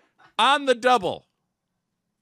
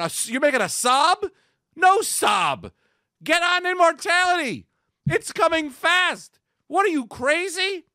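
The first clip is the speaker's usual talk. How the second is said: worked-up rant; 10 semitones higher, 5 dB louder than usual. Acted. Comic rant about immortality.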